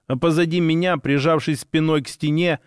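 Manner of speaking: ordinary delivery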